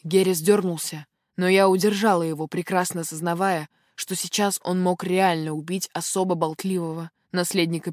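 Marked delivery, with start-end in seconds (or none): none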